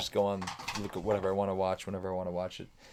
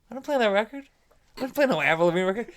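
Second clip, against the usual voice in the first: high-pitched retold protest; incredulous denial; 11 semitones higher, 5 dB louder, faster than usual. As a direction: deny it in baffled disbelief. Retold denial of his own credit.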